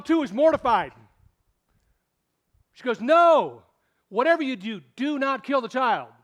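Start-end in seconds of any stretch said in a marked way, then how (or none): 3.0-6.1 high, loud, desperate plea; pleading mom. Retold or imitated. Mother begs the king to spare her baby, loud desperate plea. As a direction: beg him to spare the child.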